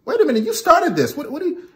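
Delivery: high, indignant protest, trailing off; mock protesting guy. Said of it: "And you're like".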